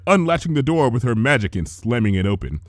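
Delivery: usual speaking voice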